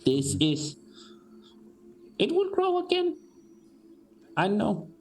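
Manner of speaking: high, thin wise-elder voice; old orc woman. Acted. GM as the elder orc woman answering the party, high old voice.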